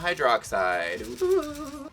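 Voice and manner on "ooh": high squealed ooh; excited ooh. Real, not acted. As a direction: squeal in delight. Cheers at the barium hydroxide.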